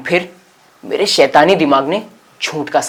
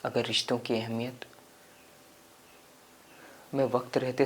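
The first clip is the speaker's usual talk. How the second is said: subdued regretful storytelling; 2 semitones lower, 11 dB quieter than usual. Acted. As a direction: confess regret quietly, with pauses. (पछतावे भरी धीमी आवाज़ में)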